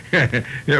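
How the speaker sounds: normal talking voice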